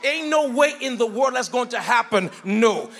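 loud emphatic refusals, slow and heavy; hard no. Shown as a demonstration. Preacher to congregation, demonstrating a street-style hard no, loud. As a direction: slam the door with every no. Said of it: "give a hard no".

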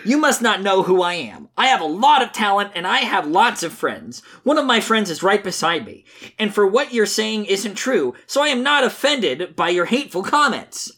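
high, sing-song earnest Sonic. Acted. Reader voices Sonic defending his friend, high and earnest. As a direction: preach friendship in a high, naive hero voice. Reads Sonic's comic lines.

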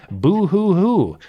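slow hammed-up mock sobbing; fake crying. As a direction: boo hoo like a taunting bully. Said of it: "your poor grandparents".

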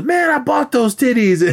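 high-pitched retold protest; mock indignant outburst. Boyfriend breaking in on the dance floor, mock indignant. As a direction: burst in with hammed-up outrage.

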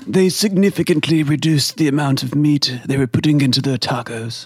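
lightly voiced David Attenborough narration; a hint of a hushed nature documentary narrator. Host reading the review as David Attenborough, lightly voiced. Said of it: "This is Sir ... David Attenborough".

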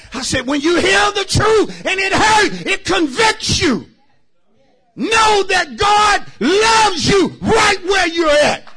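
high, loud and slowed repeat; shouted preaching repeat. Real, not acted. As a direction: hammer it home to the church. Shouted second take.

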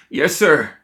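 high, loud frightened squeak; scared underling squeaking out yes sir. A narrator's reading. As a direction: yelp it out like a cornered mouse. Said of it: "the man squeaked".

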